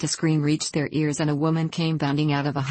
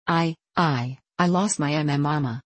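plain reading voice

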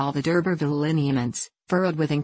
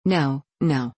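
quick. A narrator's reading. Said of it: "she said quickly".

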